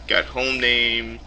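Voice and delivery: normal talking voice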